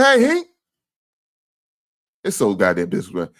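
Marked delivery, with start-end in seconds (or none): 0.0-0.5 high, loud mock greeting; mocking viewer greeting. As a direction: ham up the viewer's cheery hello. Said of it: "He said".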